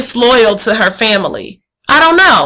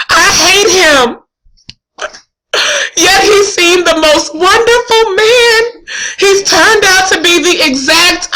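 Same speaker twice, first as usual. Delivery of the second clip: raised, emotive read of the scene; dramatic play reading; 5 semitones higher than usual. Acted. Student reading Juliet's lament aloud, emotive reading. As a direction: perform Shakespeare lines with feeling.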